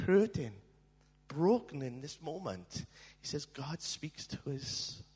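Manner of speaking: normal talking voice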